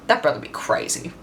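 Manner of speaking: whispered, almost voiceless. Retold or imitated. Woman on the bus whispering to the narrator about the dealer. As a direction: breathe it out in a whisper. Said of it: "stares off in the distance and goes".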